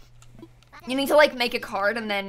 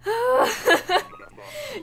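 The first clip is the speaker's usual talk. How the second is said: high laughing sigh; 13 semitones higher than usual. Real, not acted. Long pause after another duplicate.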